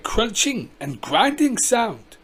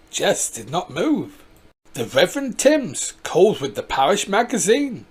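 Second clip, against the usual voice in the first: ordinary delivery, nothing marked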